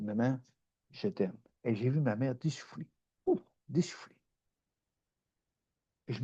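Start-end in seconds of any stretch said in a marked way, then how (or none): 0.0-1.4 softer, lower tender words to mother; quiet loving retold line. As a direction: say it gently, like a hug. Hugging his mother in story.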